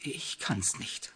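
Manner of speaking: slow, soft, breathy sigh of defeat. A narrator's reading. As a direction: sigh it out helplessly. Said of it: "seufzte sie".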